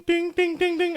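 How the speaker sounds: high, sing-song dings; high chiming ding. Retold or imitated. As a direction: mimic a game chime. Imitating game sound.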